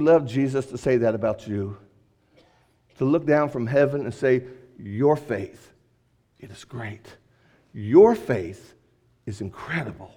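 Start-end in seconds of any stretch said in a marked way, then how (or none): 4.8-10.1 lower, very soft, intimate; hushed heavenly voice. Acted. Preacher voicing Jesus from heaven, low and hushed. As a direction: speak it down from heaven, gently.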